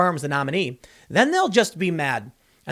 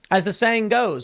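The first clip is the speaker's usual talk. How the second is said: ordinary talk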